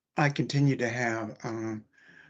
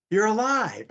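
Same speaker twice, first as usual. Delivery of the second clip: higher, louder astonished exclamation; amazed; 10 semitones higher, 6 dB louder than usual. Retold or imitated. EMT stunned to see the patient alive, exclaims higher and louder. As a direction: disbelief that he survived, said up high and loud. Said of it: "just absolutely blown away".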